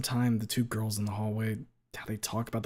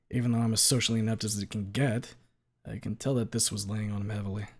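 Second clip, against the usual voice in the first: normal talking voice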